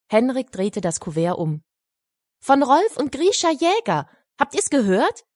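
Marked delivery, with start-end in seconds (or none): 2.4-5.2 put-on high, excited boy calling to everyone; calling out loud. Henrik calling to the children about his letter, higher and excited. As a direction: call it out higher to the whole hall. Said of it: "rief er laut".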